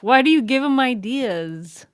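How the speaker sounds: ordinary talk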